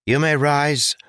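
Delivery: a lightly voiced royal command; declaring. A narrator's reading. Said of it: "a voice declared".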